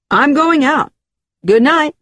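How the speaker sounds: heated, emphatic sign-off; angry parting shot. Acted. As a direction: end the argument forcefully and walk out. Man storms out of quarrel.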